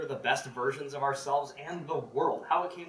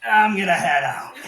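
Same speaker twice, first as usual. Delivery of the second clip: hasty exit; 7 dB louder, slower than usual. Retold or imitated. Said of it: "And he's like".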